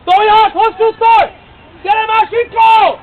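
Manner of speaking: slow, high, very loud yell; urgent shouting. Real, not acted. Coach urging Sinkó on, shouting long and hard. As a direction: bellow it, drawing the words out. Urging players on at full volume.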